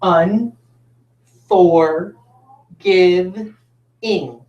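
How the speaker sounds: each syllable of "Un, for, give, ing" said alone; syllables split apart. Shown as a demonstration. Teacher counting the four syllables for the class. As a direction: break "Un, for, give, ing" into spaced pieces.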